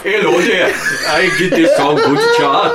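loud laughing mock Geordie. Joining the joke, loud laughing Geordie. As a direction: shout it like a hammed-up Geordie.

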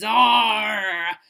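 loud, drawn-out pirate "arr"; pirate growl. Pretend. [name] plays pirate captain on the bench, loud growl. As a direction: roar it like a ship's captain. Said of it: "Let's pretend the bench is a pirate ship".

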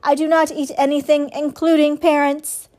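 lightly mechanical statement of fact; robot's normal voice. A narrator's reading. Robot denying it to the goose, in normal robot voice. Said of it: "returning to her normal voice".

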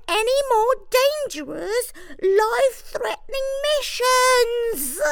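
very high, whiny squeak; a put-upon little mouse. A narrator's reading. Jimmy whines on.

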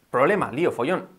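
ordinary talk, nothing marked

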